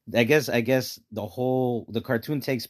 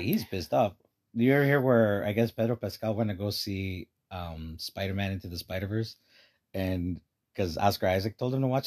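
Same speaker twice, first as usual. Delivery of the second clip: normal talking voice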